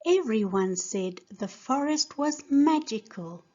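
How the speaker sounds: normal talking voice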